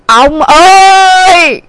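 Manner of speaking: long drawn-out, aggrieved call; accosting husband. A narrator's reading. Wife accosts husband coming home, drawn-out aggrieved cry. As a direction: hail the husband with a slow wail. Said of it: "bà Mười như đạn đã lên nòng".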